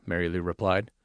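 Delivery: ordinary delivery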